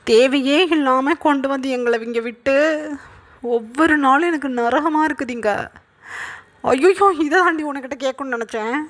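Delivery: high-pitched, exasperated mock yelling; complaining yell. A narrator's reading. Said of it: "அப்படின்னு சொல்லி கத்துறாங்க wine". (எரிச்சலில் உயர்ந்த குரலில் கத்தல்)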